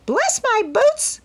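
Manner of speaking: very high, slow, comic parrot squawk; squawking bird exclamation; funny parrot call. A narrator's reading. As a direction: croak it out like an astonished parrot. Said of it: "in such a funny way".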